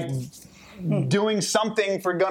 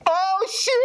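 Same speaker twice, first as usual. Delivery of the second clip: surprised exclamation. Retold or imitated. Democrats react to Trump's reversal.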